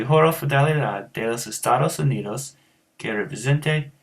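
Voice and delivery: Spanish with American country vowels; countrified-accent Spanish. Shown as a demonstration. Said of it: "countrified accent".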